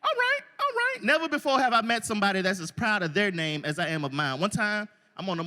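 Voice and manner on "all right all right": impressed surprise. Retold or imitated. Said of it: "They be like, Oh".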